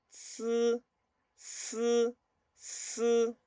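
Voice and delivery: "zi" as a pure blown s, no t, high and level; blown s sound. Shown as a demonstration. Teacher showing learners the S, "zi" blown as s. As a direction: blow steady air through "zi" like a hissed s. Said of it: "Y ahora soplo aire".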